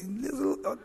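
soft, laughing, slowed quote of a wife; a hint of the wife, half laughing; preacher quoting his wife with a quiet laugh; mock wife quote. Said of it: "They can say".

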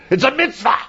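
high-pitched nagging mother's yell; mock shout. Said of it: "she would shout at you".

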